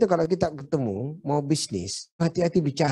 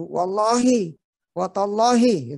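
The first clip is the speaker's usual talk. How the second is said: higher and louder, stressing each oath; 7 semitones higher, 4 dB louder, slower than usual. Retold or imitated. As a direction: swear it firmly like a haggling trader. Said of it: "harus tegas kalau bicara".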